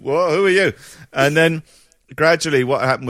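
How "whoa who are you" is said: put-on high, loud little boy's startled cry; a small kid meeting a stranger in his house; boy finding a lost patient in the hallway, startled. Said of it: "this small boy going".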